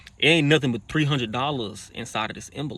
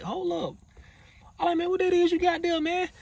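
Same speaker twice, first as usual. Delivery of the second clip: surprised demand. Retold or imitated. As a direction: catch him out, voice leaping up. Spots the hidden cash.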